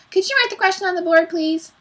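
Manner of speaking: high, fast mimicking whine; mocking. A narrator's reading. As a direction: parrot the pupil's question mockingly. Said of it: "In a mocking voice".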